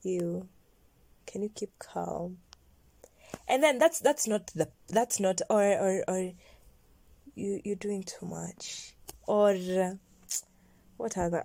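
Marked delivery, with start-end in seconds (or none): 0.0-2.4 softly imitating his put-down; quiet imitation of a reproving man; hushed mock reproach. Imitates him telling her off. 7.3-8.9 slow, whispery imitation of his scolding; soft, drawn-out mimicked criticism; hushed mock reproach. Imitates him telling her off.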